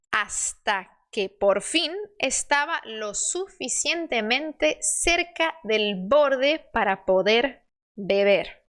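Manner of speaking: slow, measured Spanish story reading for learners; slow model reading. Shown as a demonstration. Teacher reading the crow fable to learners, slow model reading. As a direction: read each phrase carefully, spacing the words.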